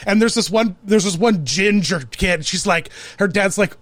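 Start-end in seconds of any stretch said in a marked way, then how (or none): none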